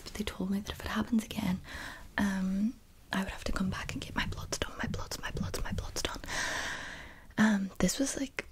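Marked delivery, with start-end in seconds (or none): none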